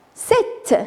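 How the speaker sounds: high, crisp sept with audible t; final T pronounced. Shown as a demonstration. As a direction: sound the T ending sept, P silent. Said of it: "on prononce le T". (sept avec T prononcé, P muet)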